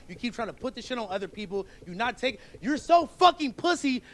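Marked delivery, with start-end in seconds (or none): 2.6-4.0 real anger, louder and higher; slow, forceful, heated accusation; angry insult. Real, not acted. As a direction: throw it hard and loud, word by word. Angry confrontation with the host.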